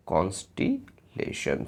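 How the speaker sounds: Constellation slowly in two parts, pause before lesson; broken into parts. Shown as a demonstration. Breaks word up after spelling it. (Constellation को धीरे-धीरे, कॉन्स्टे और लेशन में तोड़कर बोलें)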